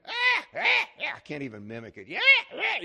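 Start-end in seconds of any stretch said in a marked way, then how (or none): 0.1-1.1 very high, loud mimicked shrieks; imitated child's scream. Father imitating son with a toothpick in his heel, screaming. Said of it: "here he comes screaming". 2.1-2.8 loud, high mimicked child's yelps; imitated screaming. Father trying to mimic his injured son, high screams. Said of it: "you know, screaming".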